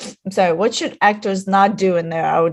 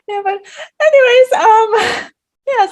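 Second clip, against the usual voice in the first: laughing; 11 semitones higher, 5 dB louder than usual. Real, not acted. Laughing about liking accented men.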